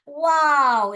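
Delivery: loud, high "वाओ" in delight; excited wow. Shown as a demonstration. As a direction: show the kids how to say wow. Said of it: "ऐसे देखिए". (बच्चों को दिखाते हुए ज़ोर से, खुशी से "वाओ")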